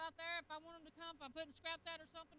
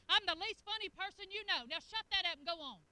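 louder, scolding dismissal; loud brush-off; 3 semitones higher, 9 dB louder than usual. Retold or imitated. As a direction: shoo him off loudly. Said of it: "And I'm like".

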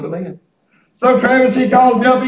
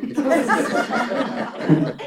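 laughing; 4 semitones lower than usual. Real, not acted. Laughing at his own outburst.

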